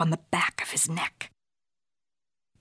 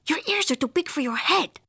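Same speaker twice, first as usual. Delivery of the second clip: high, lively cartoon voice; bouncy pitch, as a cheeky remark; exclaiming kitty boy; 7 semitones higher than usual. A narrator's reading. Skipito sizing up a Martian, exclaiming.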